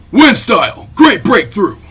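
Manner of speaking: loud battle cry; jutsu shout. Acted. Asuma unleashing his wind jutsu, shouting. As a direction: call the technique out hard.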